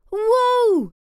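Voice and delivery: veel hoger en luider; verrukt uitgeroepen. A narrator's reading. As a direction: schreeuw het uit van verbazing. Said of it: "roept Roos uit". (meisje ziet een echte boomhut en roept luid verrukt)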